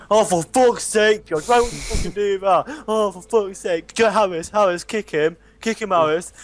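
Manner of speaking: hammed-up furious friend yelling for help; imitating raging Callum. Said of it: "in the background pissing myself".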